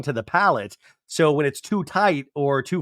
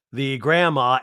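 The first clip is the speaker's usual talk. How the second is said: normal talking voice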